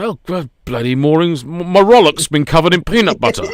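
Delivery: high, loud comic complaint; mock grumbling boater. Acted boat owner's moan.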